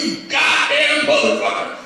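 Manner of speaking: high-pitched retold cursing; strained, high mock fury; mock angry outburst. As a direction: imitate a customer blowing up. Acts out customer starting a fight.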